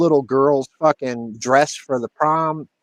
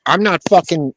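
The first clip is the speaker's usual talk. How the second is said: normal talking voice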